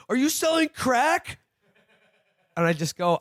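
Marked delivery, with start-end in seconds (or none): 0.1-1.4 high, mocking teen shout from a car; rowdy teen yelling. Teen leaning out of a car, taunting the canvasser, high mock shout. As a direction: yell it out the window like a bratty teen. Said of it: "And then he goes".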